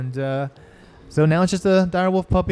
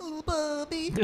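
mocking squeak; 14 semitones higher, 13 dB quieter than usual. GM mocks the line.

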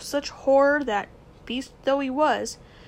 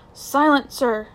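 a sharp, louder command for silence; 3 semitones higher, 7 dB louder, slower than usual. Acted. Uncle silencing the boy, louder. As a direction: slam it down like a fist on the table.

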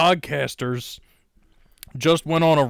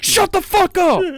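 much higher, louder screaming; mock yell; 5 dB louder than usual. Yelling at Greta in the joke story, screaming loud.

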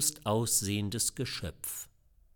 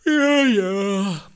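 loud high yes through a yawn; 10 semitones higher, 10 dB louder than usual. A narrator's reading. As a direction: yawn out a bored agreement. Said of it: "gähnten alle". (gegähntes Ja, ja)

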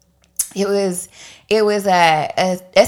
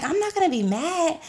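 soft, high-pitched reassurance; gently coaxing; 9 semitones higher, 6 dB quieter than usual. Retold or imitated. Woman retells promising the girl she won't be mad, coaxing. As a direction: soothe lightly so the secret comes out. Retold soothing of crying child.

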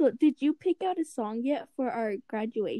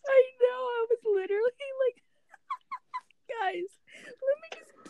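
high-pitched, breaking into real laughter; laughing; 12 semitones higher than usual. Real, not acted. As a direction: squeal it out through helpless laughter. Cracking up over the crying episode.

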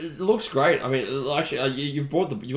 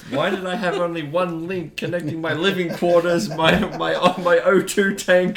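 high, loud mock dismay; mock panic; 7 semitones higher, 7 dB louder than usual. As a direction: wail about the lost ship. Mock panic over own ship.

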